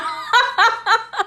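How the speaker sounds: high-pitched burst of laughter; hearty laugh. Real, not acted. Recapper cracking up at Lamar showing up, hearty laugh. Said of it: "I almost peed myself".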